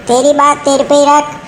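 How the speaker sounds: usual speaking voice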